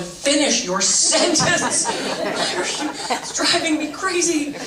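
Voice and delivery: high, drawn-out comic exasperation; exasperated. Acted. Student silently begging the slow instructor to finish, exasperated. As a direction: burst out with hammed-up impatience. Acting out inner impatience at teacher.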